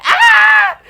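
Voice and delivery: very high, loud scream; piercing retold scream of fright; mock scream. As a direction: shriek in acted-out terror. Retold scream atop the car.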